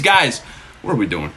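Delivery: normal talking voice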